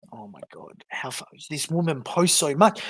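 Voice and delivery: ordinary delivery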